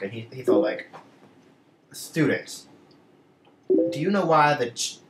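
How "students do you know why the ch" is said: slightly hammed-up teacher addressing the class; lightly voiced teacher. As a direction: mildly imitate a lecturing teacher. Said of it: "he opens up his book".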